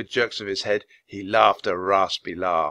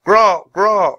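high, loud raven caws; two harsh, drawn-out caws; croaked bird call; 10 semitones higher, 9 dB louder than usual. A narrator's reading. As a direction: croak twice like a leader bird. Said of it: "Rock croaked".

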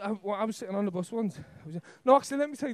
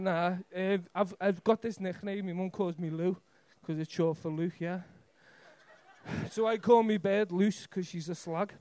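usual speaking voice

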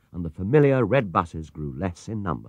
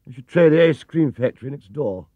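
louder, higher stern gatekeeper; sternly; 5 semitones higher, 6 dB louder than usual. A narrator's reading. Gatekeeper turning the bear away at the gate, sternly. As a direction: brush him off officiously. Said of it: "he said sternly".

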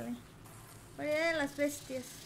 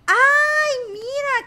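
high, loud startled cry; surprised shriek; 9 semitones higher, 13 dB louder, much slower than usual. Real, not acted. Gamer shrieking as something appears. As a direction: gasp it out in shock.

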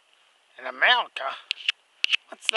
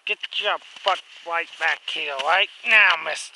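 scolding call; 8 dB louder than usual. Pretend. Calling the runaway toy back.

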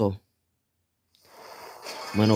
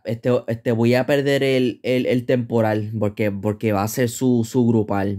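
usual speaking voice

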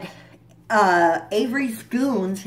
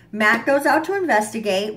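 usual speaking voice

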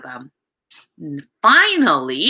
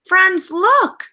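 put-on much higher and louder, calling the kids to look; bright call to look; excited call; 10 semitones higher, 4 dB louder than usual. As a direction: grab the children's attention with delight. Calling children's attention.

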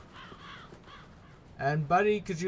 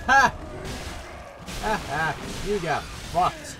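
gloating taunt; 3 semitones higher than usual. Real, not acted. Gloating at enemies.

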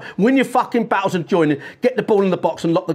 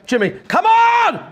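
high, drawn-out shout to a player; calling out; 12 semitones higher, 4 dB louder than usual. Real, not acted. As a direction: bellow his name across the pitch.

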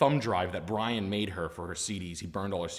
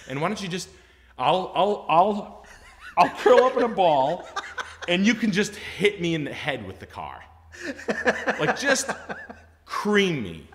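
high, loud pleading through laughter; the salesman begging the customer to run him over, laughing; laughing plea; 9 semitones higher, 8 dB louder than usual. Retold or imitated. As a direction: beg while cracking up.

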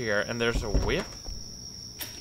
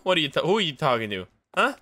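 normal talking voice

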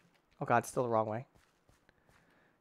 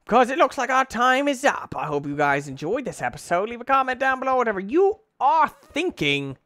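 loud, high outro shout; hammed-up sign-off; 9 semitones higher, 9 dB louder than usual. Real, not acted. Gamer closing the episode to viewers, loud hammed-up sign-off. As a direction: belt out the farewell, stretching the final phrases.

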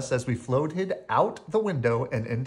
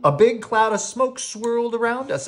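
ordinary delivery, nothing marked

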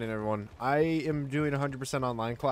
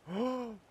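sharp gasp; 11 semitones higher than usual. Real, not acted. Spilling drink on stream.

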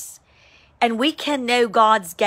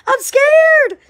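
scared lion, mocked; 7 dB louder than usual. God describing a frightened lion, shrill mock squeal. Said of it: "a lion isn't going".

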